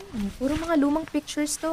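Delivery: usual speaking voice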